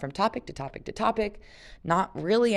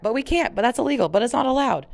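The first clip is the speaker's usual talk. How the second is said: higher, whiny mock of Luke's objections; 7 semitones higher, 5 dB louder, faster than usual. Reader mocking Luke's endless objections, higher whiny mimicry. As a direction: mimic a fussy rule-follower. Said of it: "how many times is he gonna say that?".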